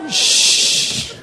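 long, drawn-out shush. Real, not acted. Speaker to a rowdy young audience, long shush. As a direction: hush the room with a steady hiss.

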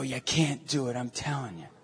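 very soft, low urging; gentle, earnest. Retold or imitated. Priest to a stranger who wanted to give up, softly earnest. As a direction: plead quietly, close by.

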